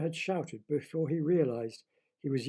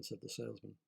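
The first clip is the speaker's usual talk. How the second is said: usual speaking voice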